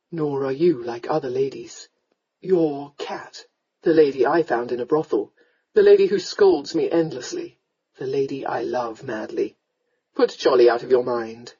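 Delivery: low, ardent man's voice; loving lord. Acted. Lord tells his lady to forget it, lovingly. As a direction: declare it low, firmly and fondly.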